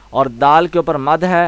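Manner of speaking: normal talking voice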